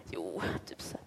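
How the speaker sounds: whispered quote. Retold or imitated. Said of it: "så säger de alltid".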